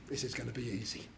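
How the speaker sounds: soft, quick smug thought; smug inner thought. Acted. Husband thinking alone, quiet and fast. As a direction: mutter it to yourself smugly. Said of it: "the husband thinks".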